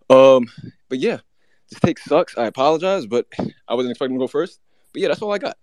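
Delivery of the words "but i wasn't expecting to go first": ordinary talk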